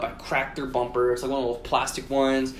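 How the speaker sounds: usual speaking voice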